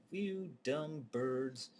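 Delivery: slow, drawn-out furious outburst; exploding rage. Retold or imitated. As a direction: explode at the birds, slowly. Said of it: "explodes into".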